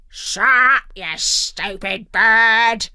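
loud, drawn-out growl; irritated pirate snarl; angry pirate growl. A narrator's reading. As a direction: growl at the bird as a surly pirate.

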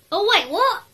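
sudden confused jolt. Real, not acted. Startled by something in the game. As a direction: caught off guard, voice jumps up.

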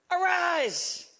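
high, ringing preacher's command; retold command, pitched well up. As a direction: proclaim it as a divine order. Jesus commanding Elijah, preached.

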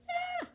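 short, very high "ah"; high-pitched squeal. Real, not acted. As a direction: let out a tiny high squeal. Reacting to a convicting verse.

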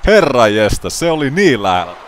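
high, loud, laughing excitement; excited shouting. Real, not acted. Commentator thrilled by a near pin, high excited shouting. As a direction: yell at the near fall.

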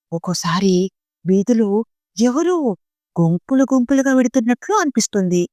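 usual speaking voice

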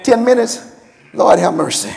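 usual speaking voice

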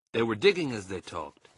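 usual speaking voice

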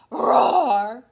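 loud playful roar; dinosaur roar. Pretend.

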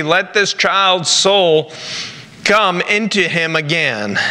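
ordinary talk, nothing marked

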